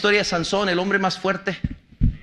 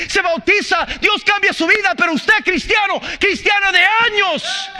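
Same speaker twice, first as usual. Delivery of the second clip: full-force preaching; 7 semitones higher than usual. Acted. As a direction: drive it home to the congregation. Preaching builds to shouting. (predicación a todo pulmón)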